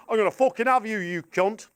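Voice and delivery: lightly voiced Leeds hooligan threat; football hooligan. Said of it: "Leeds United football hooligans going".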